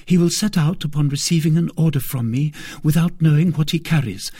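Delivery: put-on high, brisk young woman voice; confident young maid. A narrator's reading. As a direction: lay out the plan eagerly. Maid explaining plan.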